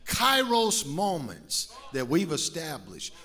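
loud, driving sermon voice with music under it; full force preaching. Acted. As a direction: preach it hard to the whole church.

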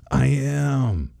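high, drawn-out exasperated retort; mock frustrated. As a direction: imitate a fed-up teenager snapping back. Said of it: "He gets so frustrated".

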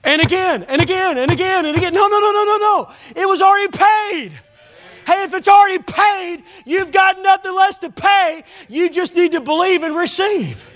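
high, insistent pulpit preaching. Real, not acted. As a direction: pile up the repeats, then protest emphatically.

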